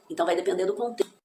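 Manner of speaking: usual speaking voice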